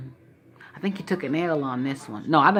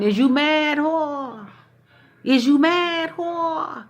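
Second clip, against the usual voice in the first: mocking callers; 10 semitones higher, much slower than usual. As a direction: taunt her like the callers did. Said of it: "callers called in and kept saying".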